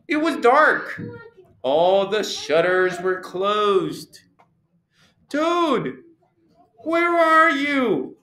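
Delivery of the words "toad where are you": high searching call. A narrator's reading. Frog searching the dark house for Toad, calling. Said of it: "called Frog".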